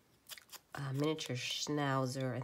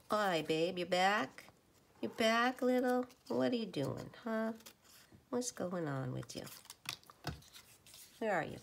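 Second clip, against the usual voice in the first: a higher, sing-song voice for the cat; pet talk; 7 semitones higher than usual. Real, not acted. Woman greeting her cat in a higher, cooing pet voice.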